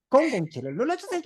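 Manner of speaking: high-pitched, lightly acted ad line; parroted TV ad. Pupil answering angry teacher with an ad line, playful recital. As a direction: chirp it like a copied commercial.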